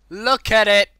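very loud, high burst; excited shout. Real, not acted. Gamer showing off the piggy, excited shout. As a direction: shout it with delight. Shouts about the cute pig.

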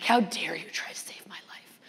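a hammed-up whispered scolding; mock outraged whisper. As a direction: whisper the indignation as a joke. Retold mock outrage at rescuer.

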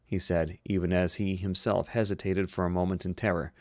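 plain reading voice

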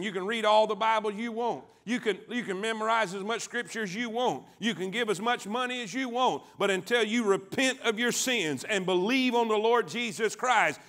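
ordinary delivery

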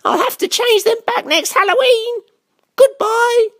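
high, squeaky witch voice; high-voiced witch; witch character. A narrator's reading. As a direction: pitch it right up as a storybook witch. Story witch speaks after spell fails.

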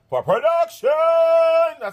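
high, loud shouted catchphrase. Real, not acted. Vlogger shouting her catchphrase to viewers, loud and high.

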